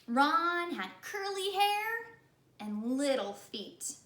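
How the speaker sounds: slow, lilting storybook read; storyteller voice for children; children's story narration. A narrator's reading. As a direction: read it like bedtime to kids. Reading a children's story aloud.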